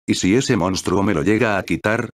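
usual speaking voice

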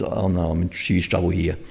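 low, soft, sinking oh-no; hushed dismay. Acted. Balaam before the angel, quietly dismayed. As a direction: drop your voice in mock dread.

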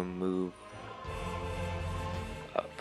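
ordinary talk, nothing marked

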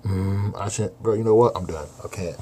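ordinary delivery

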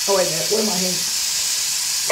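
ordinary talk, nothing marked

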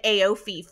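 AOIFE as three slow syllables, "ay-OH-fee"; spelling misreading. Shown as a demonstration. Admitting her old misreading of the name, sounded as spelled. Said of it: "in my head, I said".

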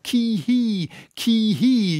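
high, drawn-out yelled whoop; high-pitched oarsman's whoop; Daggoo. A narrator's reading. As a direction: answer with a wild cry, held back in volume. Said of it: "yelled Daggoo".

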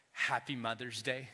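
normal talking voice

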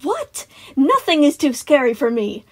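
put-on high, indignant little boy; arguing, boastful child. A narrator's reading. Boy insisting he can handle scary things, indignant. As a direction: puff up your chest and protest. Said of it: "Tyler argued, puffing up his chest".

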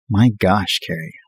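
plain reading voice

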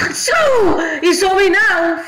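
panicked high shout; scared horror game streamer. Real, not acted. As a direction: yell in fright. Monster spotted them.

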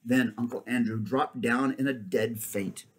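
ordinary delivery, nothing marked

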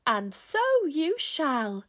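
high, loud and drawn-out pronouncement; loud, booming announcement. A narrator's reading. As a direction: proclaim it like a magical visitor. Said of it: "came a loud voice".

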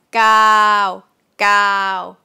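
gao short, contrasted with glue. Shown as a demonstration. Teacher contrasting short gao with glue. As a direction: clip gao hard. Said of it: "As opposed to glue".

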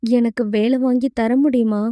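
plain reading voice

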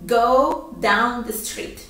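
patient slow repetition; slow teacher model; slow model phrase. Shown as a demonstration. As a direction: Go down the street slow and clear. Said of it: "Yo voy a decir".

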